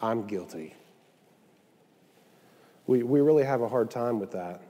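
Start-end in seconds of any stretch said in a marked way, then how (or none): 0.0-0.7 very low, soft admission of guilt; humble confession. Acted. Sinner coming to the Father to confess, low and humble. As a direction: confess it quietly, pitched right down. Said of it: "come to your Father and say".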